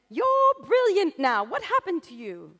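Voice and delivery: very high, slow, loud teacher voice of sudden praise; surprised teacher. Retold or imitated. Teacher praising the student's found-art monster, high and loud. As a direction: gush at the student in mock amazement.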